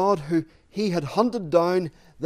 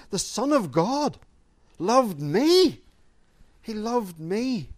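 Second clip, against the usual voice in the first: higher-pitched, drawn-out amazement; Paul's incredulity; 4 semitones higher, slower than usual. Acted. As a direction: marvel at it, lingering on the last words. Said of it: "You can hear the incredulity in Paul's voice".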